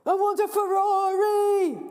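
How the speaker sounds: high, loud, slow greedy demand; shouting boy. Acted. Greedy boy shouting his wish at the dog, high loud. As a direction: shout it like a spoilt kid. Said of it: "says the boy".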